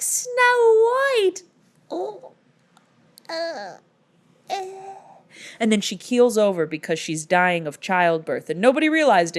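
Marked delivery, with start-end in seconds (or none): 0.0-1.4 very high, loud sing-song squeal of the name. Retold or imitated. Mother naming her baby in a soaring squeal. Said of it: "And she's like". 1.9-4.9 mock dying gasps. Dying mother's fading gasps.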